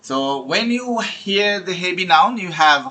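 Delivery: slow, deliberate reading of a study tip; slow slide reading. Acted. Teacher reads the tip to IELTS students, slowly. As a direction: read it out word by word like a slide.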